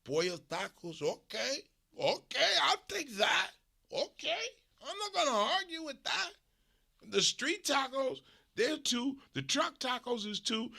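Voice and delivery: high mock Mexican vendor grudgingly agreeing; lightly voiced taco man. Imitating conceding taco seller.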